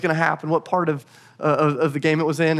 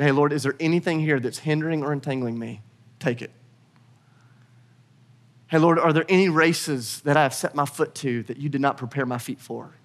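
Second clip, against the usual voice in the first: low, gentle praying voice; hushed prayer; 3 semitones lower, 4 dB quieter than usual. Acted. Preacher modelling a prayer to the Lord, hushed. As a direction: speak softly to God, searching.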